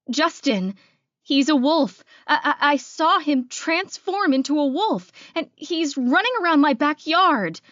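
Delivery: high, loud, stammering alarm; panicked. Acted. Frightened caller reporting a wolf in the backyard, panicked. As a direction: blurt it out in rising terror.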